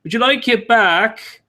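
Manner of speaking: high, slow, drawn-out question; singsong high character offer; naive wanderer offering. Acted. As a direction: ask slowly in a high innocent voice.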